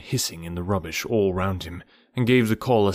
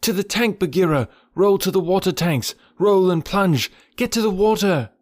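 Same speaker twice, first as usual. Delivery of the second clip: high, loud urgent shout; calling aloud; 10 semitones higher, 3 dB louder than usual. A narrator's reading. Mowgli shouting loudly to Bagheera to reach the water. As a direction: shout across the fight to Bagheera. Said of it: "he called aloud".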